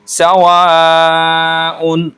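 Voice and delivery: very slow, drawn-out Quranic recitation; recited Quranic word. Shown as a demonstration. Teacher demonstrating madd for students, drawn out. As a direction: stretch the madd long as a tajweed teacher. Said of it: "मद्दे मुत्तसिल जहाँ कहीं भी होगी हम चार से".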